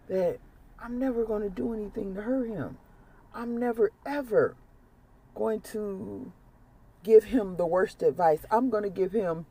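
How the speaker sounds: pleading quietly, holding back tears; emotional plea to Spirit. Real, not acted. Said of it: "I get a little emotional".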